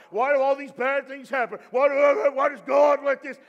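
loud mock railing at God; cranky complaint. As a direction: shout it like someone blaming God. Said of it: "cursing, granky at God".

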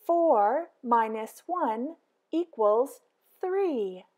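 usual speaking voice

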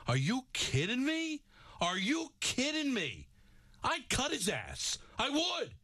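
high-pitched outraged ranting at a player; angry rant. Real, not acted. Host raging at the Giants lineman over his insult, furious ranting. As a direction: boil over, voice climbing high. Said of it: "that angers me".

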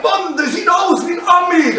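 high, excited fan's yell; imitated crowd shouting. Spectators shouting at the athlete on stage, excited yell. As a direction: call it out like an amazed spectator. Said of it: "waren Leute am Schreien".

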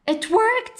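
put-on a kid thrilled his trick worked; squeaky delighted boy; young Ben cheering. Ben cheers after the storm.

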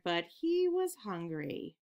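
ordinary delivery, nothing marked

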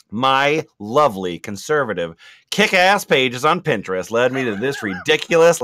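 normal talking voice

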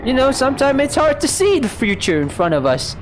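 high-pitched soothing buddy talk; sing-song sympathy for the one left behind; mock consoling.

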